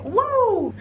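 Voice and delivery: high, stretched imitation of shocked onlookers; mock astonished whoa. Actress imitating fans gawking at a wedding photo, mock-astonished. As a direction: play the startled crowd. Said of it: "People are like".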